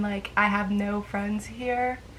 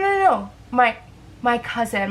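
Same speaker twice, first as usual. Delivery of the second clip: ordinary talk, nothing marked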